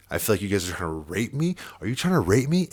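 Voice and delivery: rising panic, loud and high; frantic, freaked-out questioning; panicked accusation. Retold or imitated. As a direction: blurt it out, losing it. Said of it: "I was losing it so much".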